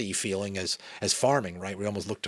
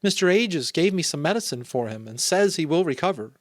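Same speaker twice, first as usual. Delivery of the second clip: reassuring mother; 9 semitones higher, 6 dB louder than usual. A narrator's reading. Mother reassures her children in story.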